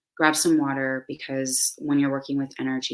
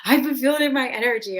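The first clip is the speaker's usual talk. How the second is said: bubbling laugh, then fast and much higher; real excitement; 11 semitones higher, 7 dB louder, faster than usual. Real, not acted. Host telling viewers she's excited, laughing and high. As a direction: let the joy spill out quickly. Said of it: "I'm excited".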